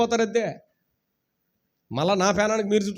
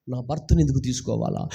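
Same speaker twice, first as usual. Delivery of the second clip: imitating a grieving widow; 9 semitones lower, 3 dB quieter than usual. Widow's imagined complaint voiced.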